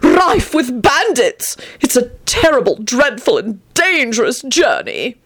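high, overwrought warning; angry, turning dark. Acted. Antonin describes the roads of Sussex, high dark melodrama. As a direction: pile on the dread, slowing to a dark finish. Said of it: "he finished darkly".

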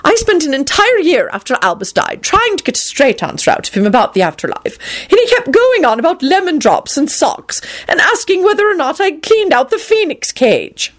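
put-on high, complaining elderly lady; exasperated old woman. A narrator's reading. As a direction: vent about it. McGonagall complaining.

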